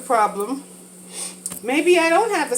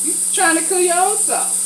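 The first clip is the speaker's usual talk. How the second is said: grandmother scolding; 8 semitones higher than usual. Retold or imitated. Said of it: "she was like".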